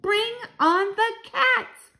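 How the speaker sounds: high, slow, loud rallying cry; excited shout. A narrator's reading. Child calls for cats to be sent in, shouting excitedly. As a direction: stretch each word into a high shout. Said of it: "I shouted".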